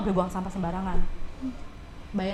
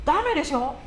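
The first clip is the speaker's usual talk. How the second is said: local's reproach; 5 semitones higher, slower than usual. Retold or imitated. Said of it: "warlock sendiri yang ngomong".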